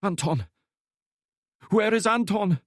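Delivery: high, loud, desperate calling for Anton; suddenly remembering her boss, crying out; frantic cry. A narrator's reading. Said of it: "her eyes lit up as awareness returned".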